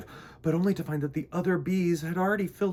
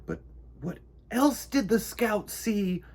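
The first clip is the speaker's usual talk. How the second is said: timid bee asking; 8 semitones higher than usual. A narrator's reading. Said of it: "Mister Bumble asked".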